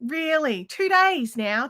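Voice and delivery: ordinary talk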